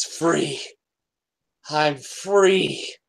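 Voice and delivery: a lightly voiced exultant spirit; joyful release. GM voicing Zatara freed by the ritual, joyful cry. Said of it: "Zatara says".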